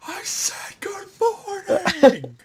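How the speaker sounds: high unvoiced hiss of a greeting; creepy whispered villain; menacing whisper. Villain repeats greeting in face.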